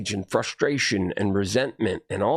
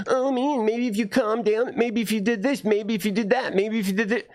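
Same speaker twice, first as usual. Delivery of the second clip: high, whiny mock advice; mocking imitation; 9 semitones higher than usual. Imitating people who advise after the fact, mocking high voice. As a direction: parody a nagging advice giver, pitched well up.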